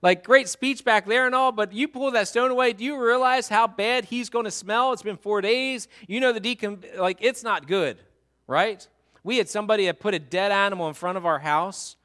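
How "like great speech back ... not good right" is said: higher, louder flustered sister; hammed-up Martha begging him to stop; exasperated Martha. Martha warning Jesus about the smell, higher flustered protest. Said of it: "Martha ... says to him".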